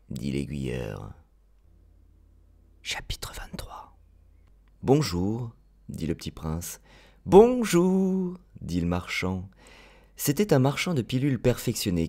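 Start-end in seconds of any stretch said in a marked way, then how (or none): 2.8-4.0 breathy whisper, chapter announcement; whispered chapter title. A narrator's reading. 7.2-8.4 high put-on merchant greeting; a lightly voiced odd salesman; merchant's hello.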